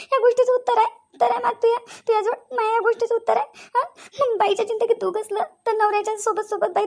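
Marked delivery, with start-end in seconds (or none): 0.1-5.4 pleading. Acted. Wife pleading for an answer.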